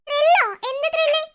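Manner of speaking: mother puzzled by her daughters' teasing; incredulous. Acted. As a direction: scoff at the word in disbelief. Mother baffled by kids.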